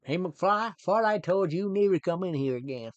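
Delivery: bully him in a hammed-up Texan accent; put-on Texas accent. Bully to McFly as if in Texas, mock Texan accent. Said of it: "but it was in Texas".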